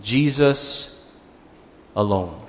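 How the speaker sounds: low, soft, separated words; solemn focus. Real, not acted. Preacher wrapping up on those two words, solemn and hushed. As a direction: set each word apart quietly. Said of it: "focus in ... those two words".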